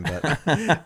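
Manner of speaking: ordinary talk, nothing marked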